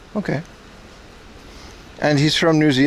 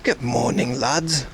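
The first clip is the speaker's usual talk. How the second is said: mock British; 4 semitones lower, 4 dB quieter, slower than usual. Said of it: "Nah, that's British".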